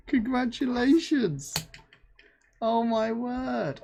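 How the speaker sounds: excited congratulations. Real, not acted. Host congratulates the winner, slow and delighted. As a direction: stretch it out in delight.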